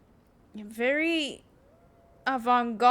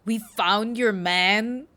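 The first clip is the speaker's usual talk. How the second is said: loud, drawn-out disbelieving question; incredulous echo; 6 dB louder, slower than usual. Acted. Player questioning the reply just read, loudly incredulous. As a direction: throw the line back slowly and louder, as a question.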